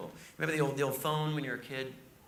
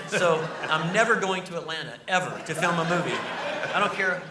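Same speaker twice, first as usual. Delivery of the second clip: loud laughing vow; 3 semitones higher, 7 dB louder than usual. Real, not acted. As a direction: declare it loud through laughter. Vows never to return, laughing.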